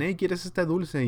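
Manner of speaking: lightly voiced uncle coaxing a small child. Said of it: "si le pregunta a un tío".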